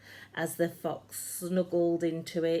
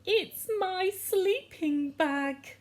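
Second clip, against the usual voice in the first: crying fox; 13 semitones higher, 3 dB louder than usual. A narrator's reading. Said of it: "a fox who was crying".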